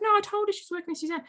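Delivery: high, quick mock client voice; naive client. Client insisting to her coach, high and fast. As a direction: rattle it off as if it explains everything. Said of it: "She's like".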